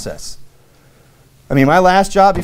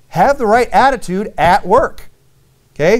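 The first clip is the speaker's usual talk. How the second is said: emphatic preaching; 6 semitones higher than usual. Acted. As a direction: preach it, each word weighted. Preacher drives home sermon point.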